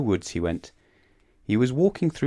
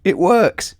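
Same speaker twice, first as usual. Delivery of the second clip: high, loud, amazed discovery; marvelling storybook character; loud wonderment; 11 semitones higher, 9 dB louder than usual. A narrator's reading. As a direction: exclaim it in astonished delight. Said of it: "out loud in wonderment".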